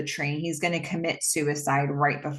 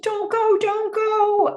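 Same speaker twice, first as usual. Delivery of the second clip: put-on very high, louder child's plea, repeating it; desperate little girl; 5 dB louder, slower than usual. Daughter pleading as her father lets go of her hand, high desperate plea. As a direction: beg him over and over not to leave. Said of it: "She's like".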